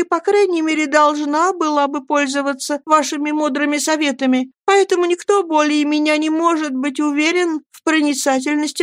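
high-pitched, apologetic woman's voice; meek, deferential mother. Acted. Mother defers to Jane's wise advice, high and meek.

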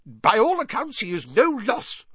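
high-pitched, loud dismissal; Fudge blustering. A narrator's reading. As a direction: bluster to brush the whole matter aside. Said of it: "blustered Fudge".

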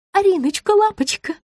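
high-pitched cooing; affectionate. A narrator's reading. Rita greets her client on the phone, affectionately. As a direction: coo the pet names tenderly. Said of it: "ласково произнесла та".

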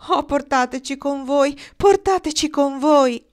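high, loud plea, building; high-pitched entreaty; children's pleading shout. A narrator's reading. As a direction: beg loudly like excited children.

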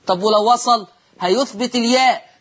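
ordinary talk

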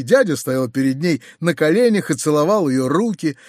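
plain reading voice

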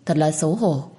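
plain reading voice

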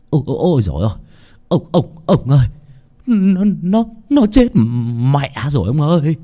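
frightened servant's stammering cry; panicked cry. Acted. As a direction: blurt it out in fright. Servant cries out at a death.

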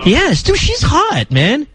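high, excited insisting. Real, not acted. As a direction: gush about her eagerly. Insisting on his pick excitedly.

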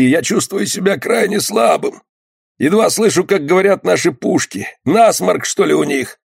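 high-pitched plaintive voice; high, querulous wounded man; wounded skipper, complaining. A narrator's reading. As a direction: lightly play a suffering, grumbling sailor.

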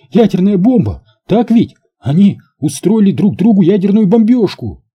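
high-pitched, alarmed outburst; panicked squeal. A narrator's reading. Alex raving about the nuclear bombing, squealing. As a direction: keep squealing it out, high and urgent. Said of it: "взвизгнул Алекс".

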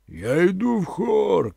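high, slow, drawn-out call down the cliff; shouted call. A narrator's reading. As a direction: call out to the riders below, stretching it. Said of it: "крикнув він".

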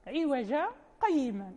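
عوجا said without tanween, then a short silent stop with no breath before قيما; slow recitation with a small catch after عوجا; brief pause after عوجا. Shown as a demonstration. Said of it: "يعني يسكت يسيرًا دون تنفس".

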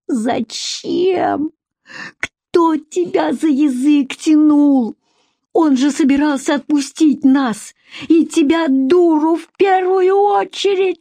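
high, wailing tirade; frantic raging. A narrator's reading. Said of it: "бесновался Егор".

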